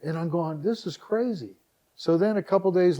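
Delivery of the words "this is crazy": high-pitched, marvelling disbelief; retold amazement. Website owner swamped by calls and requests, amazed. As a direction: lift the pitch as if overwhelmed. Retells reaction to endless calls.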